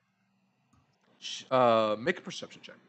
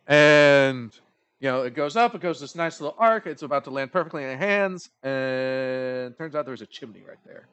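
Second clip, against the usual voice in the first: ordinary delivery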